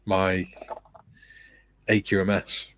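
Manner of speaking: normal talking voice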